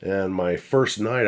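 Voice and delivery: ordinary talk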